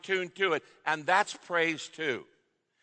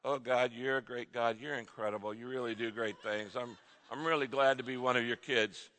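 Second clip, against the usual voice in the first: low, soft, monotone prayer; without emotion or passion; 6 semitones lower, 6 dB quieter than usual. Shown as a demonstration. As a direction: recite it with no feeling at all. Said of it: "with a little bit of emotion and passion. Not like".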